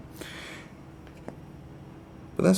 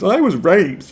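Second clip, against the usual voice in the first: high, loud mock sob; mock crying comedian; 12 semitones higher, 10 dB louder, slower than usual. Imitating a comedian crying on stage. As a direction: wail it like a hammed-up crying comic. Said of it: "cries and goes".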